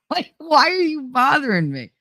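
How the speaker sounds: high, whiny mock exasperation; exasperated. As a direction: complain to a pest who keeps texting. Acted exasperation at persistent texter.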